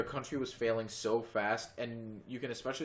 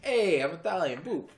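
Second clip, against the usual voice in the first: loud, high cartoon Italian accent; mock Italian accent; 7 semitones higher, 7 dB louder than usual. Mocking a friend's failed accent as loud, cartoonish Italian. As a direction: belt out a hammed-up Italian accent. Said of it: "All my accents end up being, like, Italian".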